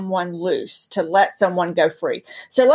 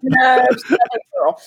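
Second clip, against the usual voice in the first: proud laughing shout; 5 semitones higher than usual. Real, not acted. Jokes she's not from New York.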